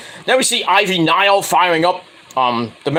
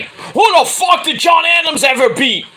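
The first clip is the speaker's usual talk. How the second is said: much higher, louder indignant shout; high loud mock outrage at a student; ranting shout; 11 semitones higher, 4 dB louder than usual. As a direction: yell it as an outraged question. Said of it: "starts going into a rant".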